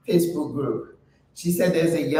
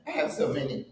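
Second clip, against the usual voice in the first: ordinary talk, nothing marked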